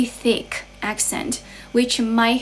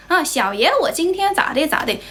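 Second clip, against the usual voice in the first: higher-pitched mock Beijing swagger; Beijing accent; 6 semitones higher than usual. Shown as a demonstration. Streamer showing viewers a regional accent, Beijing accent. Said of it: "就是北京腔".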